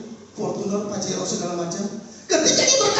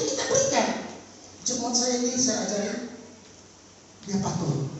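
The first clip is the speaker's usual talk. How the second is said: normal talking voice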